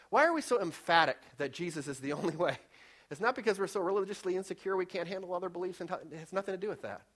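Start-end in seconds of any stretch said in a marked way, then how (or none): none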